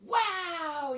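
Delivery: high, loud cheer of delight; yelling kids. A narrator's reading. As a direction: shout it like kids let loose. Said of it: "yelled the kids".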